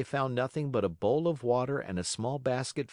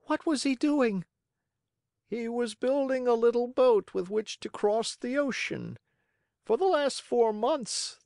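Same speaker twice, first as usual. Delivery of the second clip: high-pitched character dialogue, urgent; high, pleading child and earnest bird; pigeon and anxious puppet; 14 semitones higher, 3 dB louder than usual. Acted. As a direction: voice both figures high, with urgency.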